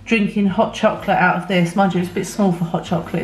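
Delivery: ordinary delivery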